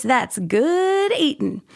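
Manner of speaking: slow, hammed-up Texas drawl on good eatin'. Texas accent on the punchline to co-host. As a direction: stretch it out like a Texan at a barbecue. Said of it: "a little Jody accent".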